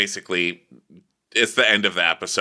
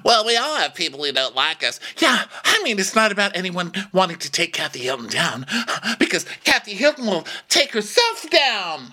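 hammed-up arguing housewife; 11 semitones higher than usual. Cast member protesting about Kathy Hilton, hammed-up high indignation. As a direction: play an outraged reality star, pushed high.

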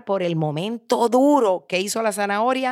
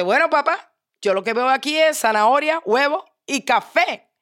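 higher, louder, bright-eager daughter reply; daughter answering; 7 semitones higher, 4 dB louder than usual. Acted. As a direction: answer Dad in a raised, lively voice. Daughter answers father in story.

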